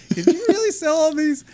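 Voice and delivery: high, lilting naive question; imitating Emily. Imitating Emily quizzing the RV dealer, high and naive. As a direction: play dumb and curious, pitch up high. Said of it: "if I can't do the accent".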